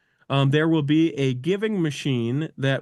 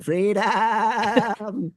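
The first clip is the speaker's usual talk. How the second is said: bleating goat voice, higher; 6 semitones higher than usual. Acted. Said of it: "Your goat impression is m-, impeccable".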